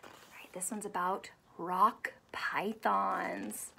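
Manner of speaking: soft whispered aside; hushed storytime voice. Acted. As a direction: lean in and whisper. Whispering to listening child.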